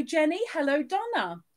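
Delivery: ordinary talk, nothing marked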